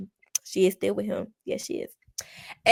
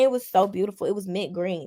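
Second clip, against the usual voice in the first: ordinary delivery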